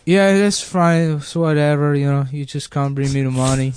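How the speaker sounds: older Mexican landlord, lightly mimicked; Mexican accent. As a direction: casually agree in an imitated Mexican accent. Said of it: "like, Mexican guy showed me".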